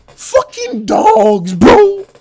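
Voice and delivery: very high, slow, loud cry; ecstatic scream. Real, not acted. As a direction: scream it with pure joy. Screams over the match.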